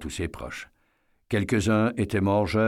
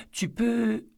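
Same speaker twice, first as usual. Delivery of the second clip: voix de fillette, très aiguë; petite fille qui demande. A narrator's reading. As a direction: demande timidement, tout en haut de la voix. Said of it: "une fillette de huit ans". (voix aiguë de petite fille)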